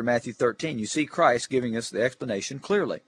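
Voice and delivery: usual speaking voice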